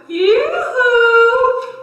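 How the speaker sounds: high, loud sing-song yoo-hoo; shrill hammed-up call for help. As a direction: call her over from far across the field. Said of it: "He called the old woman".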